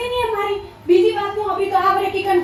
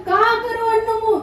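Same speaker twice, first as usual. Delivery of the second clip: ordinary delivery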